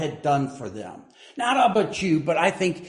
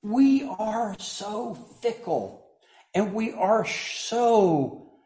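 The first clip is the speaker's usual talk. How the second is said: slow emphatic; 2 semitones higher, slower than usual. Real, not acted.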